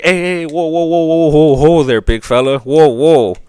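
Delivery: high, hurried "whoa there, big fella", easing a spooked animal down; mock-panicked oil executives soothing a beast; nervous oil men calming a horse. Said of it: "the oil companies were like".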